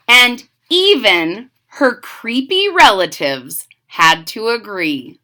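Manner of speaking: put-on lively read-aloud for kids; storybook narration. A narrator's reading. Reading a witch story to children, lively narration. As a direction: tell it like a bedtime picture book.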